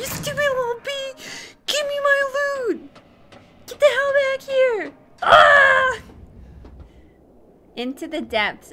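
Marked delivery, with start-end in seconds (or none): 0.0-6.0 high-pitched outraged shouting; angry yelling. Real, not acted. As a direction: yell after the thief, ending in a loud scream. Yelling at girl who stole loot.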